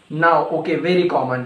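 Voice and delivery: ordinary talk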